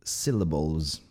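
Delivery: SYLLABLES ending in a buzzing Z; final Z sound. Shown as a demonstration. Teacher models the plural for learners, final Z. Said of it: "Esse S vai ter som de Z".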